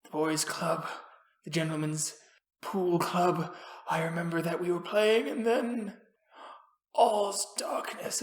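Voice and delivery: soft, faint voice of a spent man; drained survivor fading out; exhausted. Acted. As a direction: murmur weakly, piecing the memory together. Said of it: "exhausted beyond belief".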